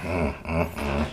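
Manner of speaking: ordinary talk, nothing marked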